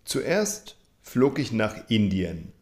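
ordinary talk, nothing marked

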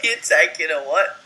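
high, loud disbelieving question; can't believe what you heard; incredulous. Real, not acted. Reacting to Callum's gross answer, incredulous laugh.

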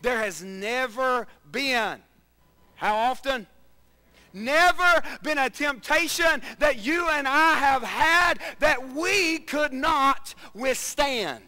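slow, loud, high preaching. Acted. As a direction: stretch it out with power. Preaching at full force.

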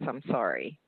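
usual speaking voice

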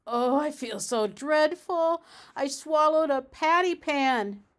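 high, loud theatrical whine; moaning and whining. A narrator's reading. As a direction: moan miserably like a dramatic invalid. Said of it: "Duchess moaned and whined".

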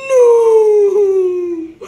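high, loud despairing cry; shouted no. Acted. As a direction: yell it as everything fails. Character cries out.

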